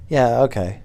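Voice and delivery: normal talking voice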